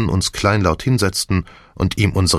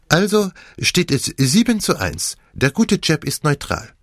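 put-on high, animated French-accented detective; Poirot; 10 semitones higher than usual. Detective sums up the vote, animated French accent. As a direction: pronounce it with theatrical relish.

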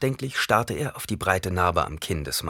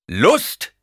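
incredulous outburst; 7 semitones higher, 8 dB louder than usual. Acted. Halleck incredulous, angry at Paul.

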